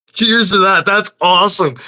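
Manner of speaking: high, loud cheer; loud excited cheer. Real, not acted. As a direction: toast it with glee. Enthusiastic exclamation.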